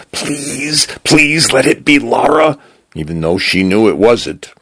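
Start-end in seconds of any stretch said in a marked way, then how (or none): 0.1-2.6 high, urgent plea; pleading prayer. A narrator's reading. Desi praying it is Laura at the door, high and pleading. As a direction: pray it desperately in a high, thin-pitched voice. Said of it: "prayed to God it was Laura".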